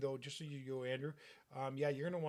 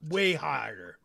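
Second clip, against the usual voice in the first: gruff father; 13 dB louder than usual. Acted. GM voices the father.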